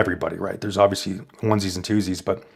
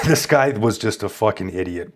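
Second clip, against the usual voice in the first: normal talking voice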